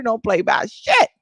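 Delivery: usual speaking voice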